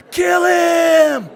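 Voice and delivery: long, high, loud yell; crowd scream. Retold or imitated. Thirty thousand people scream each charge, full yell. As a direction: scream it like a mob at a fight. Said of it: "in that room would scream".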